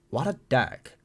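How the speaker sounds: a touch effeminate, lightly put on; slightly feminine. Thinking it about the laughing doctor, slightly feminine. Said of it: "that was a little feminine".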